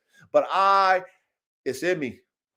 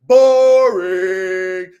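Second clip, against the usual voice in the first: Boring held long and loud; emphatic boring; 9 dB louder than usual. Real, not acted. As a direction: stretch Boring out with disdain.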